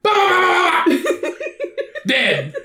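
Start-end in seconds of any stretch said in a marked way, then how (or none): 0.1-1.3 mock gunshots, high-pitched bursts; spray high vocal gunfire; high gunfire imitation. Imitating magic guns firing.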